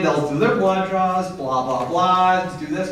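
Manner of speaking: ordinary delivery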